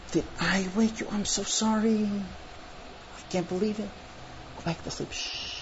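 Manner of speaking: soft, near-whispered bedtime apology; hushed apology. Acted. Wife to the husband she just woke, hushed apology. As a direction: hush him gently back to sleep.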